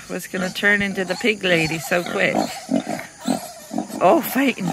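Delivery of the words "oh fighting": ordinary talk, nothing marked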